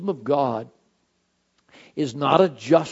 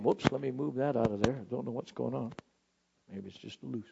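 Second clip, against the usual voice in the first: muttered aside; 3 semitones lower, 12 dB quieter, faster than usual. Real, not acted. Aside fixing the microphone.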